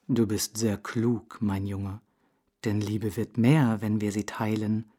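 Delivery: put-on a wise old owl explaining calmly; old owl's voice. Owl explaining to the hare, low and steady.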